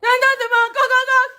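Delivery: high, loud "coq, coq" like banging on a door; urgent pounding at the hotel door; loud knocking imitation.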